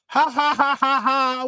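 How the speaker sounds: mock laugh. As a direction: act out a laugh in separate ha syllables. Acting out laugh inside the quote.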